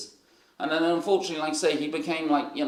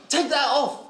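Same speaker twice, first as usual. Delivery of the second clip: high-pitched mock command; acted-out scolding, pitched up; indignant order; 11 semitones higher, 3 dB louder than usual. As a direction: imitate an exasperated leader giving orders.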